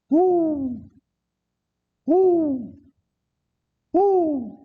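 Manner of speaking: long, high sustained hoots; imitated hoot owl. Treed escapee fooling the sheriff, high fake owl hoots. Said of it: "Ain't nothing but an old hoot owl".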